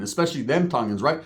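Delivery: ordinary delivery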